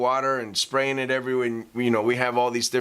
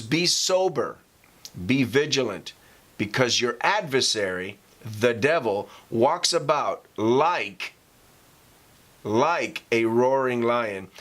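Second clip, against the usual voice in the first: slow scripture reading, long pause before each phrase; slow with pauses for emphasis; slower than usual. Shown as a demonstration. Preacher reading the verse to his congregation, slow and emphatic. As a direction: let every phrase land, slowly and deliberately. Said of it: "I read that slowly with pause for emphasis".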